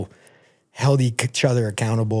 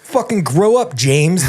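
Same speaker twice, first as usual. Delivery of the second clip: joking louder mock scold, higher; fake-yell at the listener then crack up; mock telling off the listener who asked; mock anger; 7 semitones higher, 7 dB louder, slower than usual. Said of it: "That was a joke".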